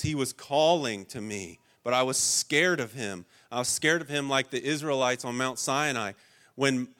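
normal talking voice

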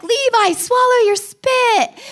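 high, loud, slow mocking kid chant; teasing siblings. Siblings teasing Levi in the car, high loud mock. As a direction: tease him like bratty big sisters. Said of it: "the kids are all like".

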